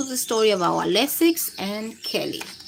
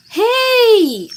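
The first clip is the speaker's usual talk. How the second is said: sharp high yell of hey; a child's loud protest; 12 semitones higher, 8 dB louder than usual. A narrator's reading. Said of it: "Ali yells".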